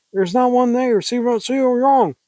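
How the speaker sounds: high, agitated insistence; high hysterical take. Acted. Acted first take, later redone lower.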